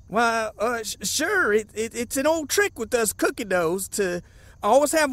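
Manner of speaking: stumbling, hesitant cover-up; flustered stammer. Acted. Fake guide questioned by the lawman, stammering excuse. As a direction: trip over the first words while inventing an excuse.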